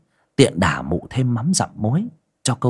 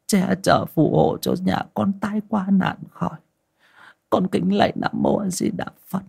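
put-on higher old woman's voice murmuring a prayer; old woman praying; 9 semitones higher than usual. Frightened grandmother praying at the altar for protection, murmured prayer. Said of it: "miệng lẩm rẩm khấn vái".

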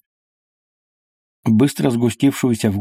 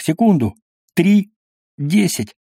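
tense counting; 7 semitones higher than usual. Acted.

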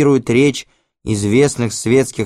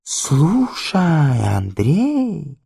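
mannered drawl; 5 semitones higher, much slower than usual. Acted. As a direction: coo his name like a spoiled socialite. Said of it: "очень манерно разговаривает".

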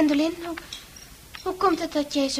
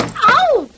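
ouch; 10 semitones higher, 8 dB louder than usual. Acted. Patient hit at table.

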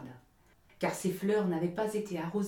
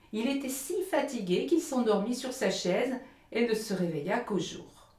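ordinary delivery

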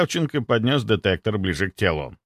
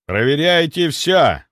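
slow, loud, raised order; commanding; 6 semitones higher, 5 dB louder, slower than usual. A narrator's reading. Captain ordering his men to search everything, commanding. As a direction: give a firm captain's order. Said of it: "приказал капитан".

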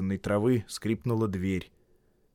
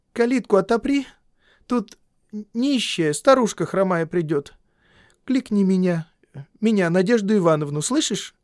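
higher bossy mistress giving orders; woman's voice; 10 semitones higher, 5 dB louder than usual. A narrator's reading. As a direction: order the boy about.

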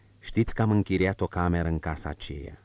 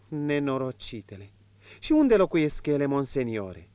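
higher, lighter woman's voice, confiding; marchioness's voice; 7 semitones higher than usual. Acted. Marchioness confiding to the prince, in a higher woman's voice.

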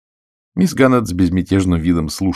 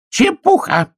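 very high, sharp retort; snapped; 4 dB louder, slower than usual. A narrator's reading. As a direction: bite the word off. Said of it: "рявкнула Кэролайн".

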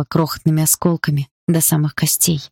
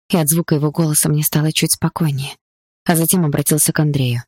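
ordinary delivery, nothing marked

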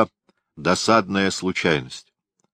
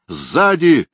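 high sharp warning yell; sharp shout; 8 semitones higher, 5 dB louder than usual. A narrator's reading. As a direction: snap it out as a warning. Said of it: "резко крикнул я".

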